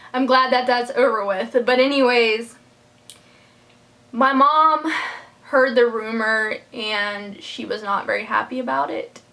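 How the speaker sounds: ordinary talk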